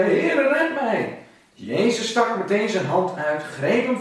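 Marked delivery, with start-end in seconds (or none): 0.0-1.2 crying out. Acted. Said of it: "riep hij".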